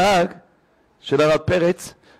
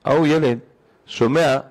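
usual speaking voice

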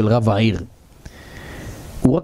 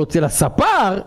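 mildly raised, puzzled question; the rabbi wonders aloud at the barber's name; astonished question; 7 semitones higher, slower than usual. Retold or imitated. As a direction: ask it in mild disbelief. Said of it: "ככה אמר לו הרב ב-, בתמיהה".

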